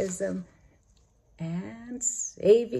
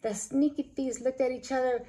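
ordinary delivery, nothing marked

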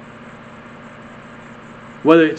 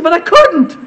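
high, loud helpless outburst; exasperated protest; 13 semitones higher, 5 dB louder than usual. Retold or imitated. Husband answering his inner voice mid-fight, loud high protest. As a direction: act out a man who cannot hold back.

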